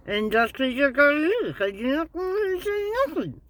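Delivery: garbled speech through a bitten tongue; mushy, tongue-trapped words rising in pitch; talking with tongue bitten. Shown as a demonstration. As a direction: stick your tongue out, bite down and talk, pitch climbing high. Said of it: "Like this, you stick your tongue out, bite down".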